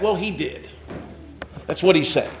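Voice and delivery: normal talking voice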